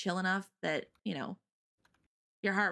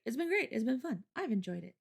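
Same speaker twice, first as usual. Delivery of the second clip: usual speaking voice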